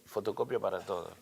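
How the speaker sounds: ordinary talk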